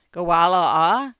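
put-on loud old man, Cantonese. A narrator's reading. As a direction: bark it like a gruff old man.

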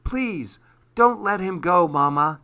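put-on high, loud child's plea; a child begging her mother, high and loud; pleading cry. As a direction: beg Mama, voice up high. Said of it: "cried Susie".

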